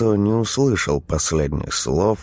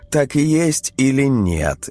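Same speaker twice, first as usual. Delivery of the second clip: wife's voice; 9 semitones higher than usual. A narrator's reading. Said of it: "спросила жена".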